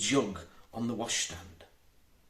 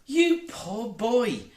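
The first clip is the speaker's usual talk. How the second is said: slow, higher, tender pity; soft-hearted; 10 semitones higher, 4 dB louder, much slower than usual. A narrator's reading. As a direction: melt over the poor boy, drawn out. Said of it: "exclaimed soft-hearted Wendy".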